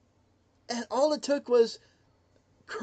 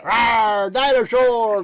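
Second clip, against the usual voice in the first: loud, roaring sign-off; dinosaur voice; 9 dB louder, much slower than usual. Acted. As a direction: bellow goodnight like a dinosaur.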